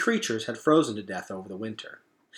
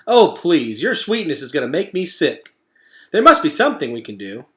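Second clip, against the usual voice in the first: higher, louder, playful protest; Digdown, mock disgust; 4 semitones higher, 8 dB louder than usual. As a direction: tease her sweetness.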